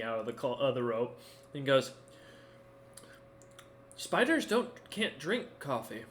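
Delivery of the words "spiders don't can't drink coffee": baffled. Acted. Bard stops short, baffled.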